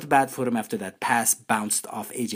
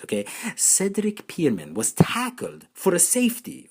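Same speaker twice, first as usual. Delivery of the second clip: usual speaking voice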